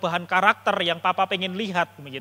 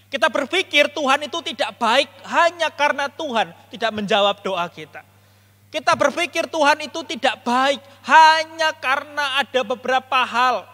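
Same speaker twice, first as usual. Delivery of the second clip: high, raised sermon delivery; impassioned preaching; 7 semitones higher, 8 dB louder than usual. Real, not acted. Preacher explains the sibling's misunderstanding to the congregation, impassioned. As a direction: drive the point home to the congregation.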